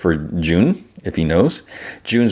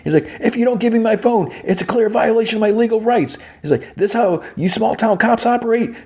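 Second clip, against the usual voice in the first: high-pitched hammed-up yell, much higher; mock yell. Suspect yelling at small town cops, high mock yell. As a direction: demand the phone in a shrill-pitched mock shout. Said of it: "And then he, he yells".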